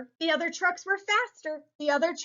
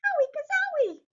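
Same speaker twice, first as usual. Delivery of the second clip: high, squeaky cartoon yelp; squeaky ouch; 12 semitones higher, faster than usual. Little truck gets bashed, high squeaky yelp. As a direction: squeal it like a dinged toy. Character hurt in race.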